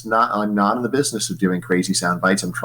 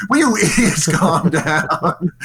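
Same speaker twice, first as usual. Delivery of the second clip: higher, slow, half-laughing mock exasperation; 6 semitones higher, slower than usual. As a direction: scold them mockingly, slowly. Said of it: "they're like".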